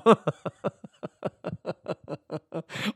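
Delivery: laughter. Real, not acted. Laughs at himself.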